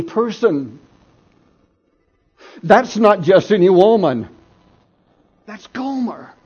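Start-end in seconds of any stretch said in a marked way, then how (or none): 5.4-6.3 a hushed, dawning realization, voice pitched up; stunned hush. Acted. Hosea stunned to spot his wife on the block, hushed. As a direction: whisper her name as it sinks in.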